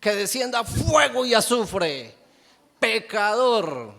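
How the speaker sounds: high, loud fire-and-brimstone cry, sung out; mock the condemning preacher, thundering judgment; judgmental preacher. A bitter preacher condemning the sinner, in a loud mock thundering cry. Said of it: "el evangelio que predica es".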